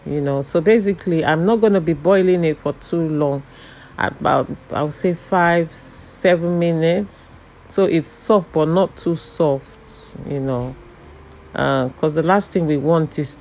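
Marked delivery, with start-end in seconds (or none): none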